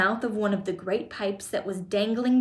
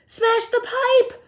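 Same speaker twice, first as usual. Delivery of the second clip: very high, slow, loud yell; 13 semitones higher, 6 dB louder, slower than usual. A narrator's reading. Mother demanding son's rescue, yelled high. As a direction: shriek a drawn-out order. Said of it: "yelled Missus Gloop".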